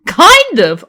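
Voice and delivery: loud, high exasperated. Acted. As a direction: throw it back exasperated. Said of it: "in an exasperated sigh".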